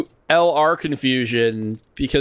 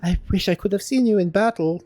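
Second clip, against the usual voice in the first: put-on high-pitched, accented kid, thrilled to meet a hero; high, excited child character; eager young adventurer; 8 semitones higher than usual. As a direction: gush like an admiring youngster.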